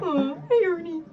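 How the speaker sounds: high, loud puppet voice with a laugh; Bert. Acted. Playing puppet pals with friend, high and loud. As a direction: greet your buddy like a puppet. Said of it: "Hey, Bert".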